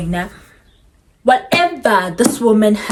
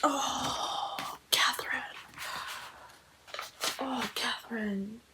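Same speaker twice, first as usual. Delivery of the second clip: softer breathless whisper of grief; 17 dB quieter, slower than usual. Real, not acted. Reader mourning a character, soft whisper. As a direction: whisper her name, heartbroken.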